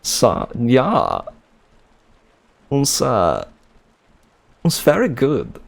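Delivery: halting mock German accent; tentative put-on German accent. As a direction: feel your way into a German accent. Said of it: "trying to say in this accent".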